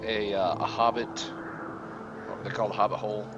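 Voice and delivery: ordinary talk, nothing marked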